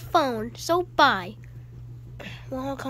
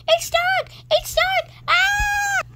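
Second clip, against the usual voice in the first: shrieking far higher and louder; panicked screams; 7 dB louder, much slower than usual. Acted. Stuck in the dark, screaming in fear.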